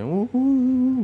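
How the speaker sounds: high imitated whimpering sobs; mock sobbing. Mocking the crying guy, high mock sobs. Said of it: "dia nangis".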